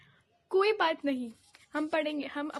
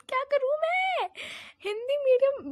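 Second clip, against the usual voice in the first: higher, louder, slowed exasperated cry; frustrated outburst; 9 semitones higher, 5 dB louder than usual. Real, not acted. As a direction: throw up your hands in despair. Frustrated student venting about SST.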